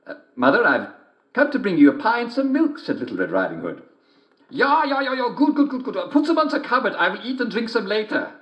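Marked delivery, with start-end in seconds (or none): 0.1-2.8 put-on high young girl voice; girl's voice. Girl speaks to mother. 4.5-8.3 high sing-song fake mother voice; wolf in bed pretending to be mother; wolf faking mother.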